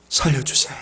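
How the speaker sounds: breathless whispered plea; a whispered cry for help; desperate whisper. Acted. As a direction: whisper it like a last prayer. Acted desperate prayer begins.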